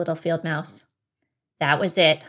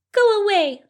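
high, loud mouse rebuff; cross field mouse; 12 semitones higher, 8 dB louder than usual. Acted. Mouse to bunny at berry patch, cross high voice. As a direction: send them off in a high little voice.

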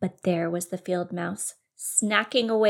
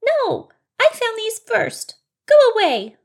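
high, loud mouse refusal; cross field mouse; 9 dB louder than usual. Acted. Mouse refusing to share berries, cross high shout. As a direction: shout them off in a high little voice.

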